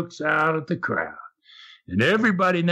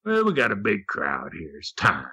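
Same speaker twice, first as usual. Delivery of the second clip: gorilla's showman voice; 8 semitones lower than usual. Retold or imitated.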